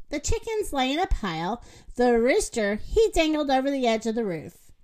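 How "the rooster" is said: ordinary delivery, nothing marked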